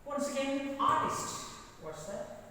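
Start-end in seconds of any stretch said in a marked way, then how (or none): 0.8-1.5 ARTIST loud, first syllable punched; stressed model word. Shown as a demonstration. Teacher has students pronounce after him, firm stressed model. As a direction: call out ARTIST for the class to repeat. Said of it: "stress is on".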